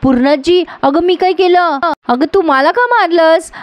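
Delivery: high, wounded whine; whiny protest. Acted. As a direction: play the hurt innocent who got slapped. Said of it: "आता लगेच ही म्हणते".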